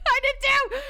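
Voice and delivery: high, loud laughing agreement; laughing excitedly. Real, not acted.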